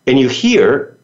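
ordinary talk, nothing marked

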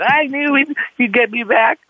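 high, adoring voice; voicing the girlfriend. Girlfriend telling the skipper he got her back, high. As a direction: say it like a grateful girlfriend.

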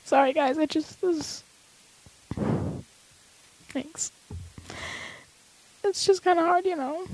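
choked-up, fading words through tears; fighting back tears, voice nearly gone; crying voice. Real, not acted.

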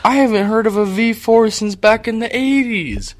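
loud, high-pitched disbelief; incredulous outburst. Real, not acted. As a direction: scoff loudly at the outdated engine. Mock outrage at friend's truck engine.

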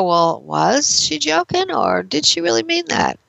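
lightly voicing a confused friend; puzzled listener. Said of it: "So then you go".

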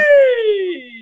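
very high cheering free; high joyful shout; excited cry. Real, not acted. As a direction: shout it out with joy. Cheering during exercise.

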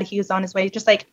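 usual speaking voice

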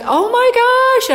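high, loud, drawn-out gasp of wonder; overjoyed high-pitched outburst; amazed exclamation. Retold or imitated. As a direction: cry it out in re-enacted astonishment. Acting out her amazed reaction.

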